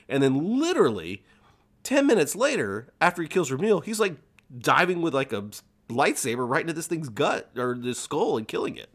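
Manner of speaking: high, loud disbelief at the twist; incredulous outburst. Real, not acted. Host outraged that Dooku kills the beast, high incredulous. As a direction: voice leaps up in outrage.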